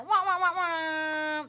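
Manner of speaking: high, loud trumpet-like wah wahs; muffled wah wah talk. Pretend. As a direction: blare the wahs like a muted horn. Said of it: "Did I do it?".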